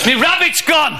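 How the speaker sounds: high mock-alarmed cry; Uncle Marvo. Magician losing his rabbit on stage, high cry. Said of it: "Uncle Marvo gets on and goes".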